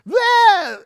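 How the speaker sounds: a loud, high mock blah; meltdown outburst. Father acting out his daughter's tantrum, a loud high blah. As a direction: blurt it out like a child losing it.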